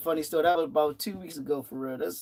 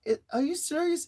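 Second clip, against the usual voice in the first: disbelief; 11 semitones higher than usual. Retold or imitated. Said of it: "I'm looking like".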